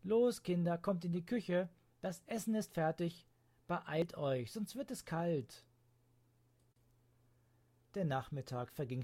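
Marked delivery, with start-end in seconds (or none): 0.0-5.7 loud, higher call to dinner; a mother calling the children to eat; lightly voiced mother calling out; calling the kids.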